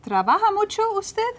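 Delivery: Trabaja mucho usted lifted high toward the end; rising question. Shown as a demonstration. Teacher models the question form, rising pitch. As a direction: sweep the pitch up like asking. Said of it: "change the inflection of my voice".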